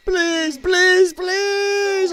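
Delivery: high, pleading please, please; mock begging. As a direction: imitate a desperate criminal begging. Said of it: "I love the begging".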